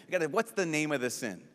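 ordinary delivery

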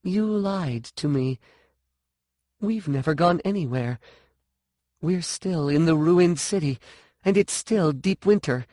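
high-pitched, hurt accusation; accusing. A narrator's reading. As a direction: confront her with the lie. Angry accusation in story.

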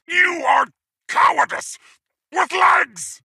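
high-pitched, outraged insult; scornful shout. Acted. Warlord berating a coward, high scornful shout. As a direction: hurl it as a furious insult.

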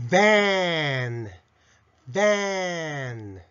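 バン as English "van", buzzing v and very long vowel; English-style van. Shown as a demonstration. Teacher modelling the quiz answer, drawn-out English van. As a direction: stretch バン slowly, teeth on lip. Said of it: "と発音します".

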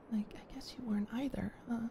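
whispered, low and shy. Acted. To the listener, a shy whispered aside. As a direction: hush it, half to yourself.

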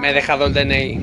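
very loud acted exclamation; hammed-up loud cry; loud outburst. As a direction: blurt it out at full volume. Dubbed character cries out.

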